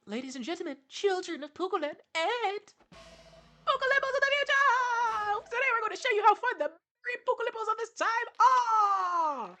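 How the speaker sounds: overexcited stage announcer hyping the crowd; rambunctious announcer. Acted. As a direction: shout it like a carnival host. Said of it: "a rambunctious announcer voice".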